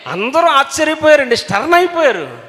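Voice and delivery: high, shouted astonishment; preacher shouting in amazement; amazed exclaim. Acted. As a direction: cry out the wonder to the crowd.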